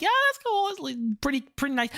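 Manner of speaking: lukewarm polite praise, rising then fading; unimpressed friends. Retold or imitated. French friends half-heartedly praising the video, lukewarm. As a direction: say it like a friend being politely meh. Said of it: "they're like".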